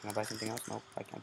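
despairing whisper under the breath. Real, not acted. Gamer despairing at his losing team, soft whisper. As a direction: breathe it out voicelessly. Muttering in despair mid-game.

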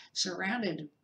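ordinary delivery, nothing marked

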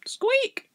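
very high little squeak; imitated high squeak; rat's squeak. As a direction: squeak like a rat. Rat replies.

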